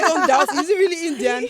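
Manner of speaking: loud, high incredulous question; acted disbelief. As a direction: shout it as if you can't believe the applause. Retold disbelief at the audience's applause.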